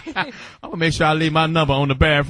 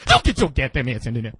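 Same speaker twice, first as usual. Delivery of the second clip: angry whisper; 8 semitones lower than usual. Retold or imitated. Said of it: "their voice always be a lot of vibrato".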